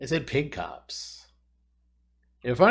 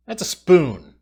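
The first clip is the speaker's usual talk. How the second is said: much higher and louder hammed-up Australian punchline; mock Australian accent; 8 semitones higher, 14 dB louder, slower than usual. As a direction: burst out the Australian punchline, high and loud. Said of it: "My flawless Australian accent".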